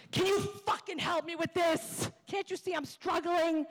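high-pitched, frustrated outburst imitating wife; exasperated wife. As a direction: act out a fed-up plea for help. Retold wife snapping while struggling with bag.

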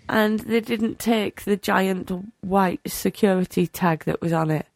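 ordinary talk, nothing marked

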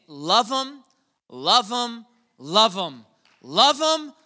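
high, loud, ringing preacher's shout; God's urging. Retold or imitated. Pastor voicing God telling him to love Zach, loud high shout. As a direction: let it ring out like a divine command, building. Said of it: "Felt God kept saying".

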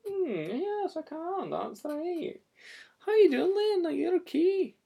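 very high, small puppet voice; wee Scottish puppet. Acted. [name] voicing shy Jane Junior to Lynn, squeaky high. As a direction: pipe up like a shy tiny girl. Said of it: "she's camera shy. but she can talk".

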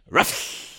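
hissy dog bark; a dog's woof ending in a snake's hiss. Acted. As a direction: correct it with a hissed ruff. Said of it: "do the voice for Snake Dog".

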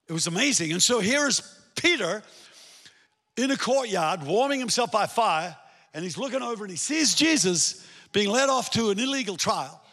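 normal talking voice

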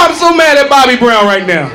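ordinary delivery

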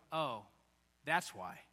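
a low, soft, flat oh; deflated realization. Pretend. Viewer seeing a fake healer, low and soft. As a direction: drop low and soft, unimpressed. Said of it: "And you go".

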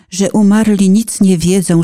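usual speaking voice

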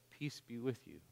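soft, calm, sure voice; calm and confident. Acted. Risen surfer speaking softly to Brad, calm and confident. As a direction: speak peace gently. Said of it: "It's calm and confident".

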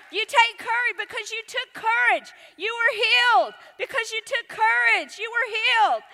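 high, loud, exultant proclamation; fervent preaching. Real, not acted. Preacher rising to a fervent peak.

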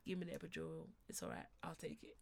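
very soft, low giving-in; re-enacted surrender. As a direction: let it sink to almost nothing, defeated.

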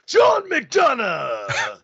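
drawn-out booming ring intro; wrestling announcer. Pretend. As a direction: stretch the name like a WWF ring announcer. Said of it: "announcer voice introductions".

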